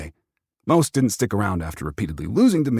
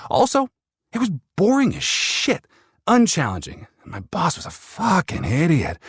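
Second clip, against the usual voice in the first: higher, fed-up venting; a scornful, pitched-up rant about a boring job; exasperated rant; 10 semitones higher than usual. A narrator's reading. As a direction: spit out the contempt for the job, pitched up. Narrator venting about hated job.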